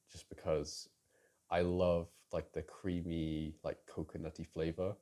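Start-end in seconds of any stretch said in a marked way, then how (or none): none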